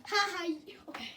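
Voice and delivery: ordinary talk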